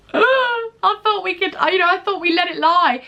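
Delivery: high, mock-wounded surprise; retold dismay. Ex-employee reacting to manager's hostile message, playful dismay. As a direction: gasp into a high-pitched protest. Re-enacts hurt shock at hostile reply.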